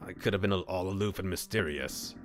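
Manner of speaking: ordinary delivery, nothing marked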